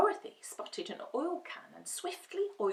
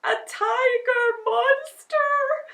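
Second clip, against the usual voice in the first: high, slow frightened whimper; cowering lion in terror; 9 dB louder, slower than usual. Acted. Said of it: "whimpered the lion".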